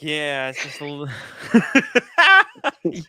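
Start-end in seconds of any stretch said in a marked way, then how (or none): 1.1-2.8 high burst of real laughter; big laugh, loud and high. Real, not acted. Laughs at own joke.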